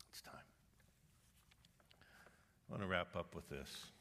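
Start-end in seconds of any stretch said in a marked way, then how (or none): 0.1-0.5 whispered urgently; whispered "It's time". Real, not acted. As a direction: drop to an intense whisper. Repeated exhortation.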